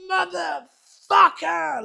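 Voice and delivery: very high, slow, bellowed Motherfucker; replaying his own goal yell at the player; retold celebration shout. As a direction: roar it out in wild celebration. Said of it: "like that".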